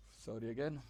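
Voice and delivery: apology still mid-yawn. Real, not acted. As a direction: say it at the tail of a yawn. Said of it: "I'm yawning".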